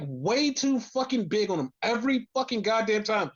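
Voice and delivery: ordinary talk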